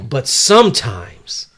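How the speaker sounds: loud, drawn-out, suspenseful narration; slow it right down and swell for effect; dramatic narrator. Acted. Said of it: "so the narrator says".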